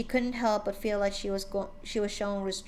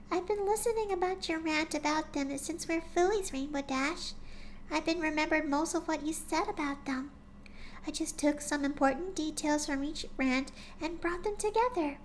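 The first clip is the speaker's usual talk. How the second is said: higher, softer timid pony voice; gentle shy pony; 7 semitones higher, 4 dB quieter than usual. A narrator's reading. Shy pony gently coaxing her friend, soft and high. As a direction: speak like a shy gentle mare.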